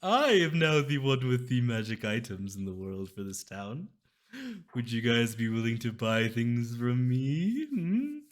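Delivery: high, loud, sly merchant voice; wheedling shopkeeper pitch. Acted. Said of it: "his merchant voice".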